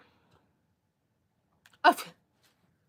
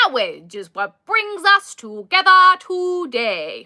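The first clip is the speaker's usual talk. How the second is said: slow, loud mock-solemn wedding officiant; Princess Bride clergyman; 4 semitones higher, 10 dB louder, slower than usual. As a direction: ham up the movie's pompous officiant line, slowly. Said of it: "from The Princess Bride".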